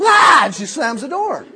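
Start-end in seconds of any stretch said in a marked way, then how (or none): 0.0-0.4 piercing high scream, far louder; startled scream. Retold or imitated. Girl in a face mask seeing her date, screaming. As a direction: shriek in shock at the door. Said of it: "and she goes".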